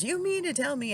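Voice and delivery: high, indignant teasing of the waitress; mock outraged husband. Husband needling the waitress over a missing dish, high mock outrage. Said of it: "he loved to harass the waitresses".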